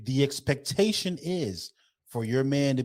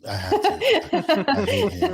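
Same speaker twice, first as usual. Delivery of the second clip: high, helpless laughter through the words; laughing; 5 dB louder, slower than usual. Real, not acted. Admitting a jab at his friend, laughing hard. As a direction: squeeze the words out while cracking up.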